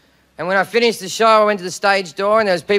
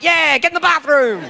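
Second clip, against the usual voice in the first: put-on pitched up, drunk and eager; mock drunken encouragement; drunken egging on; 6 semitones higher than usual. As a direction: cheer her into the bathroom, wasted. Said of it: "I'm getting involved".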